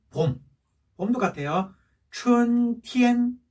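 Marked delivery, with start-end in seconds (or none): 2.1-3.4 "춘티엔" very slow, two level held notes; double first tone. Shown as a demonstration. Teacher drilling spring, "춘티엔" slow and level. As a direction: chant "춘" and "티엔" steadily on one pitch. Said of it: "이게 일 성, 일 성이에요".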